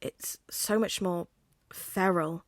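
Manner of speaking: usual speaking voice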